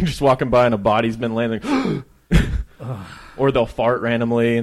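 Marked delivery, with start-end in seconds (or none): 2.3-3.3 sudden breathy gasp; demonstrated gasp of a dead body; imitated corpse gasp. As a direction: let out air like a corpse exhaling. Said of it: "one of them will just gasp".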